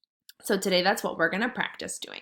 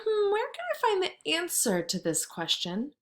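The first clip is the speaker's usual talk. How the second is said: thinking aloud; 9 semitones higher than usual. Shown as a demonstration. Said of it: "you're gonna think".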